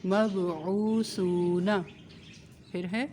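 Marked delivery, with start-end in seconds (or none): 0.0-1.7 slow مبعوثون with a soft sa, no whistle; soft sa, stretched. Shown as a demonstration. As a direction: recite مبعوثون gently, no hiss on sa. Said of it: "سیٹی کی آواز نہ آئے". (مبعوثون، سا نرمی سے، سیٹی کے بغیر)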